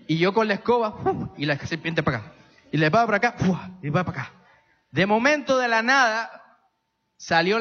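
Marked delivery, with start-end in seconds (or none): 1.0-1.3 broom swoosh. Retold or imitated. Swinging the broom. 3.4-3.7 swoosh. Retold or imitated. Swinging again.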